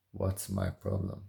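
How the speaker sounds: usual speaking voice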